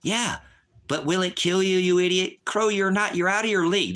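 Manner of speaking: furious teacher shouting at a disruptive student; angry shout. Real, not acted. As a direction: bark the order at the troll. Shouting at a chat user.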